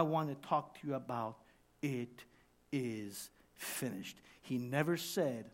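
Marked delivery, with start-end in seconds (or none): 1.8-4.2 slow, low, soft quotation; solemn hush. Acted. Preacher introduces this morning's words, solemn hush. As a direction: pause after the first word, then sink. Announces the solemn theme quote.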